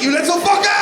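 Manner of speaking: high, loud exasperated yell; outraged shout. Retold or imitated. Dad tricked by his son about the poo, high loud outraged yell. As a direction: yell at the kid who tricked you.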